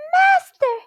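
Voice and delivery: very high, loud magic-harp cry; shrieking alarm call to the giant. A narrator's reading. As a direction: call out in alarm for your master. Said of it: "the harp cried".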